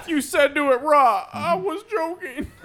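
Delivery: laughing. Real, not acted. Cracking up at own mistake.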